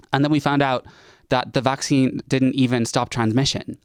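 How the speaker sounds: ordinary delivery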